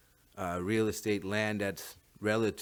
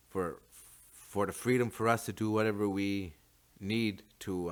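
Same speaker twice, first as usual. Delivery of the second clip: normal talking voice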